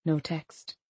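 ordinary delivery

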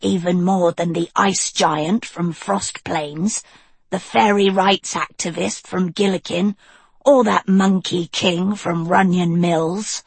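put-on cool, level young witch; Annadil voice.

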